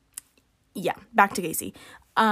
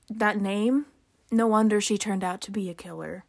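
ordinary talk

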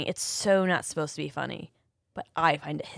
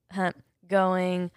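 ordinary delivery

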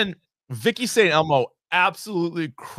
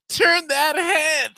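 slow, loud, drawn-out plea; mock urgent yell; 7 semitones higher, 4 dB louder, much slower than usual. Imitating MacGruber begging her to turn, slow and loud. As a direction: push her to look, hammed up. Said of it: "resorts to".

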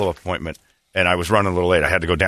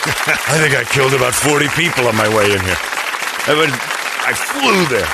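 excited; 5 semitones higher than usual. Real, not acted. Ranting about racing in.

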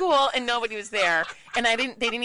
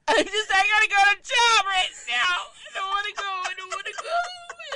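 high, loud hammed-up bawling; mock crying wail; 12 semitones higher, 7 dB louder than usual. Mom dreading her new job at school drop-off, exaggerated sobbing wail. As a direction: wail it like a child having a meltdown. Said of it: "And then I start crying".

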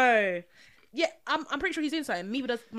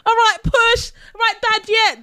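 mock midwife; 12 semitones higher, 17 dB louder than usual. Acting out delivery room.

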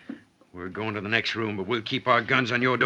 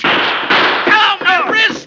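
loud, high cry of pain; pained shout; 11 dB louder, much slower than usual. Acted. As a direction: yell out as the wrist is hit. Outlaw shot in the wrist.

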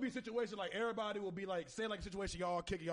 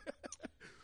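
breathy silent wheeze; wheezing laugh; 9 dB quieter than usual. Real, not acted. Laughs at water ad.

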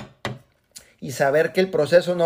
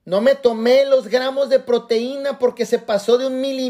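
usual speaking voice

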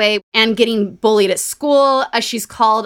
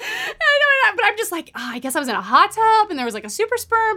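squeaky laughing pitch, much higher; 11 semitones higher, faster than usual. Real, not acted. Joking to her cohost about a surprise pregnancy, laughing high.

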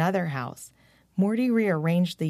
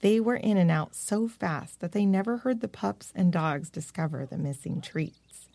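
ordinary delivery

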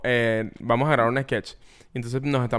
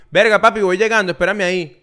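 casual call-out; 4 semitones higher, 6 dB louder than usual. Acted. Acts out a casual street greeting.

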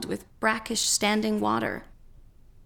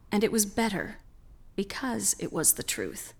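ordinary delivery

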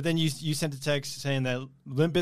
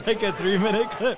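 laughing; 7 semitones higher than usual. Real, not acted. Laughing at the long clip.

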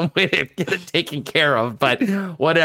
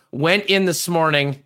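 ordinary talk

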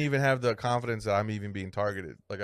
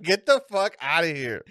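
wife imitated, amused; 4 semitones higher, slower than usual. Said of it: "She'd be like".

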